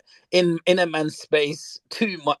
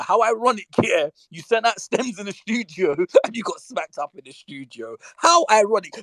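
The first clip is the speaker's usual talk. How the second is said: gleeful; 7 semitones higher than usual. Real, not acted. Gleeful mocking about karma.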